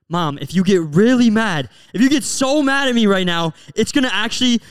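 high, building mock-earnest reasoning; joking sarcastic plea. Son to his mad mom about the cabinets, joking sarcasm. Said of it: "I was joking".

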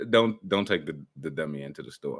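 low voice dropping very soft; hushed, deep put-on advice; low advising voice. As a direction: sink down low and quiet. Acts low-voiced advice about the dummy.

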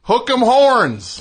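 loud, slow mock cheer. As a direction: belt it out like a fan chant. Jokey wrong team chant.

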